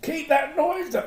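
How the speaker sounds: high, slow put-on wife voice; mock wife's complaint. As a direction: squeak it out like a nagging wife. Husband voicing wife mid-drilling.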